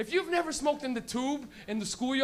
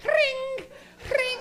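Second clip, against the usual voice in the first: high squeaky ring-ring sound; mock bike bell ring; 13 semitones higher than usual. To his friends, mocking the bike cops, high ring-ring. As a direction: chirp it like a toy bike bell.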